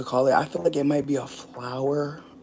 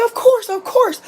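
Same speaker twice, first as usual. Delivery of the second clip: much higher, louder squeal; squeaky high quote; 8 dB louder than usual. Retold or imitated. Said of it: "He's like".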